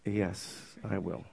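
timid, hushed reply; sheepish. Retold or imitated. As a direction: shrink back and barely get it out. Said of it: "very sheepishly".

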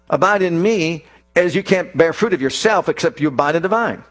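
usual speaking voice

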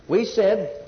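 usual speaking voice

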